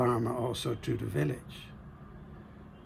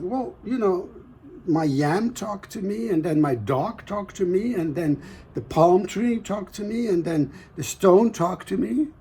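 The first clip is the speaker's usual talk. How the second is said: frightened farmer; 6 semitones higher than usual. Acted.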